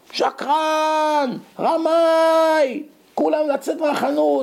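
high, very slow, dragged-out yell; stretched screaming accusation. Retold or imitated. Betrayed father screaming at the grocer in his shop, drawn-out shriek. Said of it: "הוא התחיל לצרוח".

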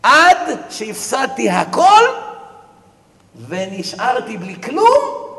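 slow, loud and high despairing cry; loud lament. Acted. Rich man telling the preacher he lost everything, loud and slow. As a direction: drag out the loss loudly. Climax of losses.

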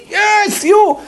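very high mock-angry call; irritated judge. Judge answering the man's "who?", acted irritation. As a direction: pick him out with acted fury. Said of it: "שמישהו עצבני ואומר לך".

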